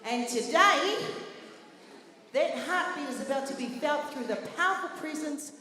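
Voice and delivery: loud, proud announcer read; event MC read. Acted. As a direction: present the group with pride. MC reads the group's introduction.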